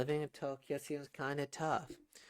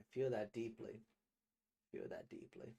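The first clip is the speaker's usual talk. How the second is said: hushed; 2 semitones lower, 12 dB quieter than usual. Real, not acted. Emotional reaction to the ending.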